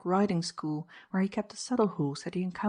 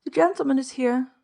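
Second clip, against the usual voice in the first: "The gentleman is here" called out higher and louder; 7 semitones higher, 7 dB louder than usual. A narrator's reading. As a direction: call "The gentleman is here" down the stairs, loud and high. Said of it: "Presently she called out".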